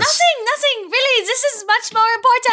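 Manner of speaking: high, fast, flustered cover-up; nervous character voice. Acted. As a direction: rush to change the subject.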